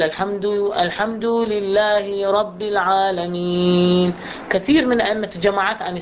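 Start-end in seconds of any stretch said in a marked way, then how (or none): 0.0-4.1 slow chant with lengthened kasra; imitating imam's error. Teacher imitates an imam reciting with the long kasra. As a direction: mimic an imam stretching لله. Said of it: "ده يقرأ بعد يقول".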